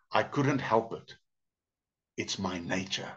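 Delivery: quiet, matter-of-fact acted confession; unrepentant snake. Snake excuses the bite.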